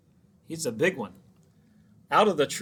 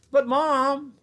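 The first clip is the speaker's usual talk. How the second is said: high, loud startled gasp; a boy left alone gasps for mom; gasped plea; 12 semitones higher, 7 dB louder than usual. A narrator's reading. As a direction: gasp it out in alarm. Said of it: "gasped Freddie".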